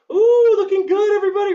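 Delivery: high, loud squealed praise for the class; excited praise. Real, not acted. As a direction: gush over how good they look.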